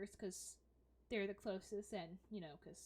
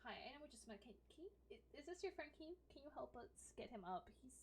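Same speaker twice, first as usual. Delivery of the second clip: softer, tentative plea; shy request; 3 semitones higher, 9 dB quieter, faster than usual. Acted. Lily softly asking Helix for help lifting Dern. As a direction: ask a stranger for help, hesitantly.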